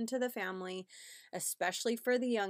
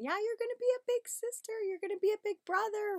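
very high, excited parent voice; high-pitched, hyped-up kid talk; exciting; 4 dB louder, faster than usual. Shown as a demonstration. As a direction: sell big news to a little kid. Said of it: "try to make it exciting".

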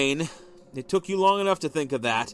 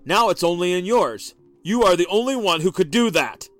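louder, higher angry accusation; husband accusing wife over the car, loud; 4 semitones higher, 7 dB louder than usual. A narrator's reading. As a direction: raise the voice, accusing her. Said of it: "he said more loudly".

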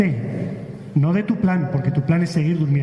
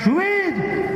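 very high, drawn-out hail; calling out. Acted. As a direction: call them aboard with the voice leaping up. Motorboat driver calls them aboard.

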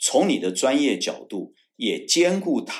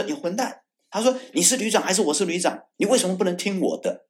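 higher, sharply scolding commander; retold angry scolding; 10 semitones higher than usual. Commander slamming the table at his officer, mock angry. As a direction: demand obedience like an angry commander. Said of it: "他就说".

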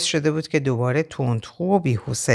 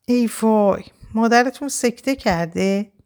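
higher-pitched dismayed exclamation; involuntary sigh; 7 semitones higher than usual. Acted. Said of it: "بی اختیار آهی کشیدم و گفتم".

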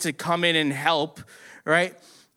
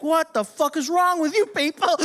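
high-pitched imitation of disbelief; exasperated outburst; 10 semitones higher than usual. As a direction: exclaim it high, appalled at everyone. Acts the worker's exasperated reaction.

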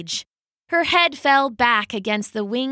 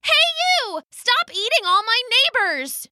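angry shout; 13 semitones higher than usual. Acted. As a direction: scold the monster with all she has. Kaya yells at the beast.

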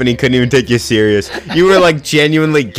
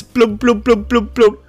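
mock bloops; 9 semitones higher than usual. Imitating drowning gurgle.

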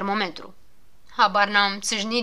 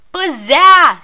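loud warning cry, higher; shout; 7 semitones higher, 15 dB louder than usual. Acted. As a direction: bellow it while racing ahead. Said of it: "striga Habar n-am".

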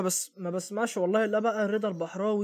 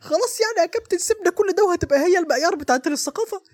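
squeaky puppet voice; 9 semitones higher than usual. Real, not acted. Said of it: "صوتك قلب على بوجي وطمطم قوي".

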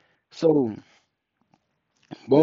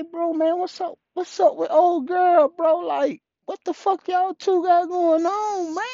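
high-pitched retold plea to a brother; exasperated appeal; 13 semitones higher than usual. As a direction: ask what is going on, high and exasperated.

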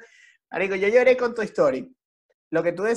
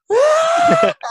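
mock wailing yell; 10 dB louder than usual. Imitating the guy wailing at Toy Story. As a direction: imitate a loud wailing cry. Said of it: "a gritar así".

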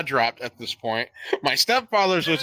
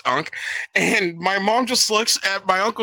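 ordinary delivery

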